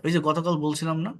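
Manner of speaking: ordinary delivery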